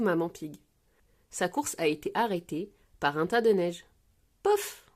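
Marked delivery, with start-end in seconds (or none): 4.4-4.9 high little poof of impact. A narrator's reading. Maman Pig landing in snow, imitated crash. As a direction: pop out a comic thud noise.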